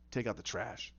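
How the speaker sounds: quiet, low mob-boss voice; low boss order. Retold or imitated. Boss tells the guys, low quiet order. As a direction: give the order low and softly.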